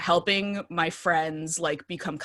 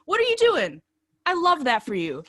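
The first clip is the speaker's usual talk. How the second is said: loud, high-pitched hyped-up affection; exaggerated doting on a roommate; gushing at roommate; 11 semitones higher, 6 dB louder than usual. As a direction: heap praise on a friend at full enthusiasm. Acts out gushing at her roommate.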